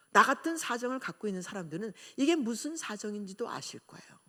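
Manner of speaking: ordinary talk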